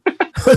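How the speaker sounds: quick high laugh; a bright little laugh of greeting; laughing as Pops Argel joins; short laugh. Real, not acted.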